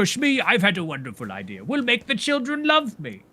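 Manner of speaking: grand pirate-captain delivery, swooping upward; Captain Hook. Acted. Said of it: "You're, you're Captain Hook from the movie Hook".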